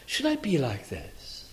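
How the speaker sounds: hushed, low and slow self-questioning; questioning himself. Acted. Psalmist asking his soul a question, quiet and slow. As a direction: drop right down and slowly ask your own soul. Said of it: "asks his soul a question".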